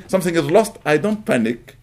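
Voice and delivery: normal talking voice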